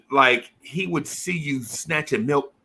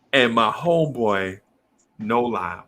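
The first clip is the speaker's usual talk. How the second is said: normal talking voice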